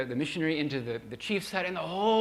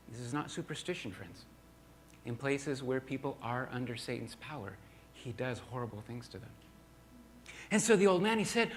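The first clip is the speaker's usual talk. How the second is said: ordinary delivery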